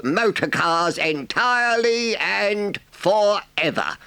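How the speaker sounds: a pleased badger speaking in a high voice, slowly; pompous, pleased announcement to friends; slow, high, self-satisfied speech; gratified badger. A narrator's reading.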